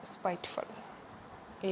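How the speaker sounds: soft, slow spiteful stress on spa; quiet stress demo. Shown as a demonstration. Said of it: "यहाँ पे लगे". (धीमी आवाज़ में स्पाइटफुल)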